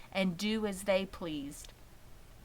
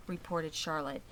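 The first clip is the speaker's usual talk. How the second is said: usual speaking voice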